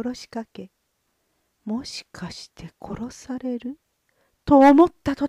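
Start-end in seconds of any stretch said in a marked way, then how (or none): 1.6-3.8 whisper. A narrator's reading. Inner thought of fear, whispered.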